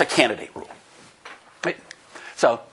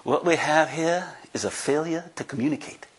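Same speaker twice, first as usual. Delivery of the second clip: slow-burning Southern prison captain, imitated; amateur take on a Southern accent; calm Southern drawl; 2 semitones higher than usual. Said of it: "He has this great southern accent, and he says very calmly".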